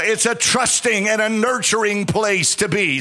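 rising, sung-out preaching cadence over the organ; preaching over music. Acted. Pastor to church, intoning over music. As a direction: carry the congregation along in a half-sung preach.

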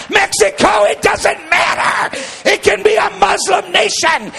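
high, shouted revival preaching; full-force preaching. Real, not acted. Preacher rousing congregation about the Spirit, full-force shouting. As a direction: roar each phrase as a rallying proclamation.

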